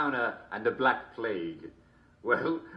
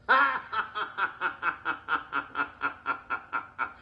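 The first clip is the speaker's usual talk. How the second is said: long delighted laugh; 6 semitones higher than usual. Real, not acted. Host laughs at best joke.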